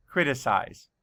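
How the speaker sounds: ordinary talk, nothing marked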